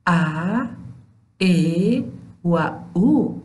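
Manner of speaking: clipped Dari short vowels ا, ای, او; teacher demonstrating short vowels; short vowel sounds. Shown as a demonstration. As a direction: say each short vowel crisply. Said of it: "سه حرف صدادار کوتاه است".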